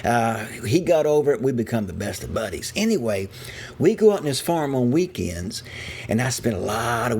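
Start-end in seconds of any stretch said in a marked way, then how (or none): none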